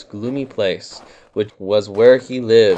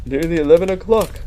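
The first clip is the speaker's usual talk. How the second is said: quick, higher, cheerful; happily; 6 semitones higher, faster than usual. A narrator's reading. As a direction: bounce it out happily. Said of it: "said Pooh happily".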